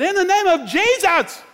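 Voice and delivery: high slow commanding call. Retold or imitated. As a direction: call the name after someone running. Chasing her, calling out.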